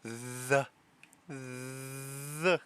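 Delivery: "The" with a long buzzing th; drawn-out th. Shown as a demonstration. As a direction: hold the th of "The", then release. Drawing out a tricky sound.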